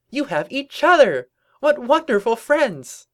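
very high, loud cartoon glee; delighted exclamation. Pinkie pushing rivals together, high gleeful cheer.

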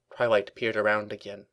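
ordinary delivery, nothing marked

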